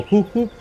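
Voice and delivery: high little horn noise, whoop whoop; imitated horn toot. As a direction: toot like a tiny delivery cart. Imitating Domino's go-kart horn.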